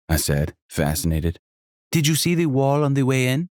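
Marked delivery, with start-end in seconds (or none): 1.9-3.5 high, lively hostess voice with a faint Jamaican lilt; a welcoming, bubbly landlady; energetic innkeeper. Acted. Sonia asks.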